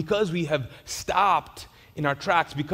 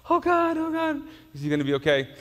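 a yelp of alarm, then rushed; frightened onlooker, jumping high then hurrying; mock panic; 10 semitones higher than usual. Bystanders fretting over the fainted man, in mock panic.

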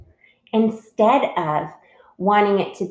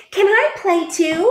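high, loud, eager little voice; little bird voice; 12 semitones higher, 5 dB louder than usual. A narrator's reading. Bluebird asks to join in, little bird voice. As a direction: pipe up as a tiny hopeful bird.

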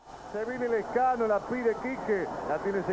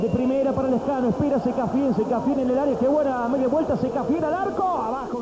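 excited build-up; 4 semitones higher, 4 dB louder than usual. Real, not acted. Loud build to shot.

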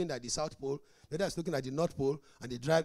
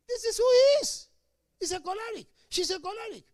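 high, loud exclamation; proclaiming; 10 dB louder than usual. Real, not acted. Preacher exclaiming to church, high and loud. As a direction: cry it out to the congregation.

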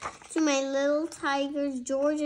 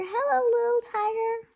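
much higher, fast cooing hello; mom's sweet voice; 9 semitones higher, faster than usual. Retold or imitated. Mother greeting her baby cub, higher doting coo. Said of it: "My mom thought she was a tiger".

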